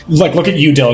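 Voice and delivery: usual speaking voice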